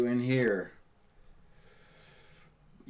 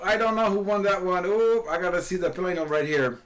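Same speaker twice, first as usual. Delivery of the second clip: normal talking voice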